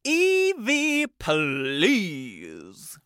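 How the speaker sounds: slow booming segment intro; drawn-out spooky announcer. Acted.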